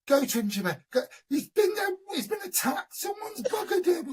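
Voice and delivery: high tearful mock wail; frantic, crying high-pitched mum, hammed up; freaky panic. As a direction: imitate a mum hysterically begging for help. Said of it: "She goes into this freaky panic".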